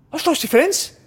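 very high, girlish mock panic; panicked wee lassie. Spotting a TV star in New York, high girlish panic. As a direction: squeak it out like a starstruck girl. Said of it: "panicked like a wee lassie".

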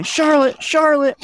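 very high, moderately loud repeated call. A narrator's reading. Bunch of kids searching for Charlotte, calling out. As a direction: call a name into the distance. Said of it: "kids kept calling".

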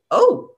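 sharp high yelp of pain. Retold or imitated. Retelling the noise she made when she fell. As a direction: cry out as your nose hits the spike. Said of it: "he heard me go".

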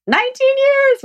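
very high, incredulous repeat; high squeal of amazement; amazed emphasis. Real, not acted. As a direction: marvel at it, pitch leaping way up. Marvels at her long marriage.

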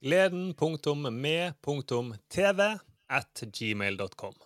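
very slow, measured spelling-out; deliberate and slow, pausing between parts; dictating an address. Real, not acted. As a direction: read out an email address piece by piece. Dictates the show's email address.

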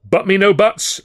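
high, loud impatient shout. A narrator's reading. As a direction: cut off the objection sharply. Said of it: "Alf shouted".

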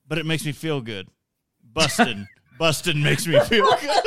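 lightly voiced innocent Paul Rudd; sing-song, laughing through it; Paul Rudd impression. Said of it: "He's like".

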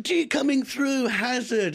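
high, loud mock siren-like call. Mum driving sister to hospital, calling out. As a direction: imitate someone shouting to clear the road.

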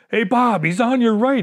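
high, loud hunting call to a friend; retold yell. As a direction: call out the warning across the ridge. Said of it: "Ron yells to me".

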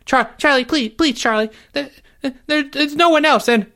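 high, stammering squeak; whimpering little-boy plea; desperate pleading. A narrator's reading. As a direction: beg frantically, words breaking up. Jeffrey clings and begs tearfully.

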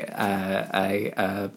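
normal talking voice

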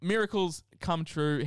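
usual speaking voice

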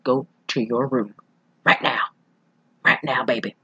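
low, soft stern mom threat; a hint of a strict mother, quiet and menacing; quietly menacing mom voice. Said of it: "she's like".

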